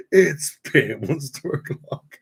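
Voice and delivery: mild mock bellow, slow; retold shout. Retelling his shout in the shop, mild mock bellow. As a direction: echo the shop shout half-hearted. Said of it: "shouted at the top of my lungs".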